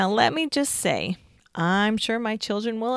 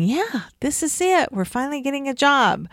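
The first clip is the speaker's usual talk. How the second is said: hopeful excitement; 6 semitones higher than usual. Retold or imitated. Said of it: "we'd get our hopes up thinking".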